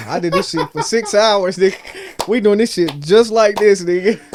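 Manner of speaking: loud, high protest broken by laughter; retold protest. Telling bandmate the hook took six hours, laughing loud protest. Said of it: "I'm like".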